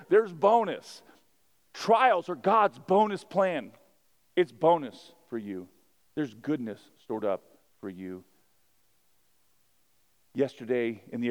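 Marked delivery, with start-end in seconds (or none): 0.1-5.7 loud, high, driving sermon delivery; full-force preaching. Acted. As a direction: preach it out to the whole room.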